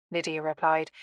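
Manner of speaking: usual speaking voice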